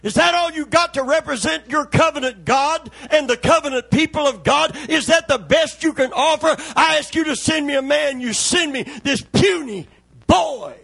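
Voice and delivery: high, loud taunting devil; scornful devil shouts at the covenant people; mocking devil. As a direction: jeer like the devil, sneering at the boy. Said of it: "hear the devil saying".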